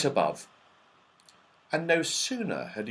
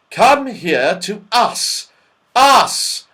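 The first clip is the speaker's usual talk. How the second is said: high, loud, building exasperation; despairing shout; 10 semitones higher, 10 dB louder, much slower than usual. A narrator's reading. Joe corrects deaf Saucepan Man, shouting in despair. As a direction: shout it, each "us" higher. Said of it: "shouted Joe in despair".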